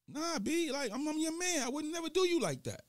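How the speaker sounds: meek promise, high and soft; docile character. Retold or imitated. Calm friend assuring the irate one, high and soft. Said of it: "real docile".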